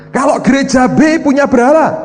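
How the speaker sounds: high, loud questioning voice; lightly voiced God. Preacher voices God quizzing him about idols. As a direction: put on a voice for the Lord asking.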